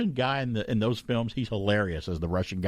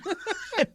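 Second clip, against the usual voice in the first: high laugh. Retold or imitated. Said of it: "singing it horribly".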